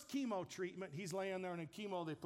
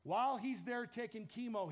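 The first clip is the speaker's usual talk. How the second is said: ordinary talk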